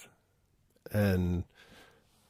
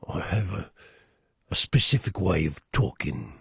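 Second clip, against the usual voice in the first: English accent. Shown as a demonstration. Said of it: "whenever I do my English accent".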